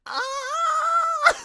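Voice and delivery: very high shrieking scream; imitated scream. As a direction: scream like falling off a cliff. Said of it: "four variations of his scream".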